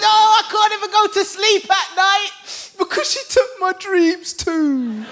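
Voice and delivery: hammed-up wail of a wronged father; wailing dad. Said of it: "And he says".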